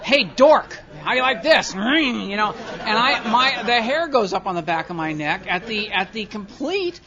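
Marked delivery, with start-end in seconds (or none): 0.0-1.7 loud, high sneering taunt; mocking taunt. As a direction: jeer at him like a schoolyard bully. Mocking tormentors in traffic.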